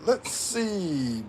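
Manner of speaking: high, drawn-out mock "let's see"; imitating own reading habit. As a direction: stretch it out high, mimicking your comment-reading habit. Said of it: "He starts with".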